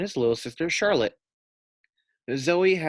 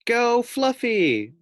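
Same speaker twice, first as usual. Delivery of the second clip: much higher, slow, drawn-out cheer; cheering boys; 12 semitones higher, 5 dB louder, much slower than usual. A narrator's reading. Boys cheering the pig in the lake, high and drawn out. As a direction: cheer him on, long and high.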